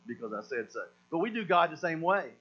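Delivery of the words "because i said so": low, soft, final parental command; stern command. Retold or imitated. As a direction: lay down the law, quiet and flat-out final. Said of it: "So now it's just gonna be the command".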